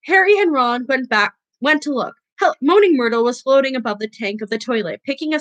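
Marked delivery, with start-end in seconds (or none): none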